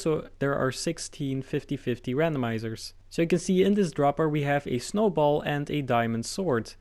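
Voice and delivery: ordinary talk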